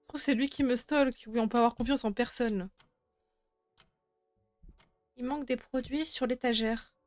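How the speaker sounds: ordinary talk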